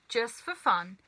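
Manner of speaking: usual speaking voice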